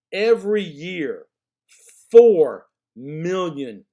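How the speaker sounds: FOUR drawn out very loud, pause before it; vocal emphasis. Shown as a demonstration. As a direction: punch "four million" slowly as a startling fact. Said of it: "delivered with vocal emphasis".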